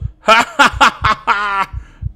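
high, loud cackle; long triumphant laugh; gloating laugh. Real, not acted. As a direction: cackle loudly over a beaten monster. Gloats over the monster.

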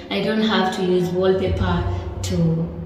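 ordinary talk, nothing marked

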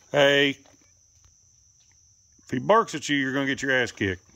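loud scolding, starting on a shout; sharp warning. Real, not acted. Owner warning a pet he'll get beaten, loud scolding.

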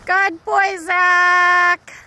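loud praise called to a dog; calling out praise. Real, not acted. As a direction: call the praise across to him.

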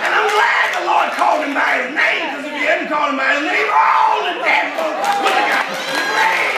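full-throated preacher build; preaching at full force. Acted. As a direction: drive it home to the church with rising fire. Preaches the closing exhortation.

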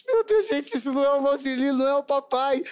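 mock panicked relative at a funeral; shrill alarmed disbelief. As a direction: squeal it like you just realised the body is a stranger. Relative panics at wrong corpse.

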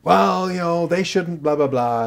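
sulky angry part talking back; angry part answering the therapist; mild mock huff. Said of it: "How come you're so angry?".

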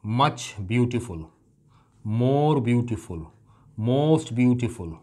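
slow, deliberate dictation; slow teaching demo. Shown as a demonstration. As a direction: spell each phrase out slowly for the class. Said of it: "जैसे मैं कहूँ".

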